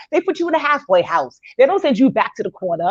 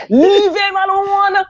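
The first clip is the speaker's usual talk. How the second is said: put-on high, slow, drawn-out Jamaican accent; hammed-up Jamaican accent, pitched up and stretched out; 7 semitones higher, 3 dB louder, much slower than usual. Panelist replaying the line for laughs, high Jamaican accent. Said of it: "in my Jamaican accent".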